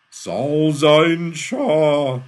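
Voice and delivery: very loud, high, long-drawn cry; powerful booming call. A narrator's reading. Eden's voice calls Shaw from empty air, powerful and booming. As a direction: boom each word out and stretch it. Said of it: "in a powerful, hollow echo".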